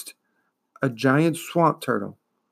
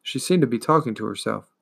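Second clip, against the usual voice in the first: ordinary delivery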